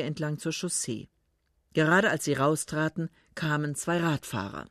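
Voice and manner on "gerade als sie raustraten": plain reading voice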